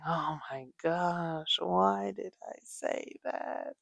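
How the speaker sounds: slow, soft dismayed self-talk; quiet regret. Retold or imitated. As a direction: murmur it in dismay at yourself. Parent regretting a promise.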